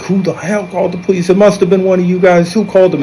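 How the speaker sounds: high-pitched mock outrage; agitated demand. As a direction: retell an accusing outburst, pitched well up. Retold gunman demanding who called police.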